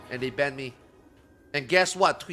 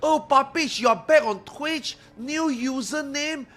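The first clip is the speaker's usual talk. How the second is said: slow, much higher mock fan greeting; mocking Russian viewers; 9 semitones higher, 4 dB louder, slower than usual. Streamer mocks fans who mistake him for another streamer, slow high mock voice. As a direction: gush like a fooled fan. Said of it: "they think that I am him".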